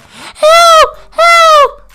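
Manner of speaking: mock scream for help. Bleeding girl screaming for help, mock falsetto shriek. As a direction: shriek for help in a hammed-up girl's voice. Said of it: "She was screaming".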